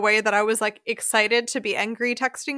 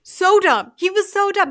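higher, louder emphatic scorn; exasperated; 8 semitones higher, 6 dB louder than usual. Real, not acted. Host venting about the dad in the movie, exasperated. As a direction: vent it, fed up with him.

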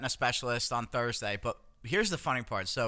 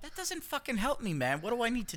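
much higher, panicky; frantic plea; 10 semitones higher than usual. Retold or imitated. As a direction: plead like you're scared. Frantic quote to doctor.

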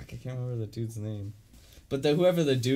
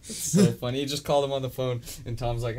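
ordinary talk

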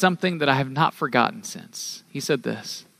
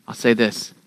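normal talking voice

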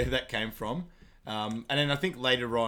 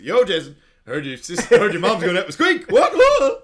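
loud, high jeering shout; mocking shout at a rival player; taunting yell; 7 semitones higher, 14 dB louder than usual. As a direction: yell the taunt across the court, then squawk.

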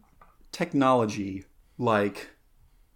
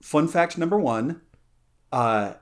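usual speaking voice

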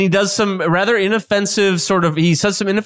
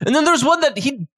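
raised chef voice, laughing through; chef character voice; 5 semitones higher than usual. Acted. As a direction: chuckle as the boastful chef.

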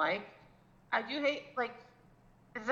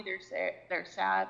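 ordinary delivery

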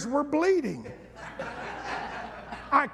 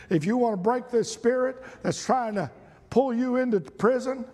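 usual speaking voice

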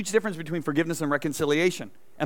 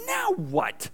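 exasperated. Retold or imitated. Exasperated inner thought.

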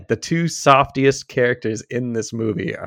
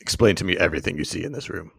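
lightly imitated action hero, low and soft; a quiet, low movie-star interrogation; Liam Neeson; 6 semitones lower, 6 dB quieter than usual. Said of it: "All right, Liam, we're gonna need you".

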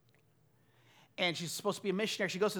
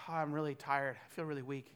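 lower, softer, worn-out voice; weary reply; 6 semitones lower, 6 dB quieter than usual. Retold or imitated. Husband answering his wife, tired and soft. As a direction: sound drained after a late night.

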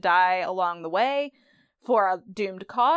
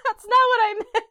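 much higher, louder mock panic, laughing through; hammed-up husband frantically taking it back; squealing no, no between giggles; panicked backpedal; 13 semitones higher, 5 dB louder, faster than usual. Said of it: "And then he's like".

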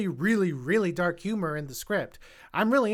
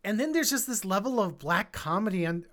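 normal talking voice

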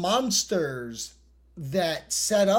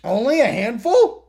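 surprised; 6 semitones higher, 8 dB louder than usual. Real, not acted. Surprised echo of co-host.

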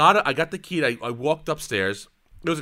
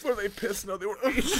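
soft, high words through giggles; worn-out laughter; 6 semitones higher, 8 dB quieter than usual. Real, not acted. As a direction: weakly repeat it as laughter fades. Laughter winding down.

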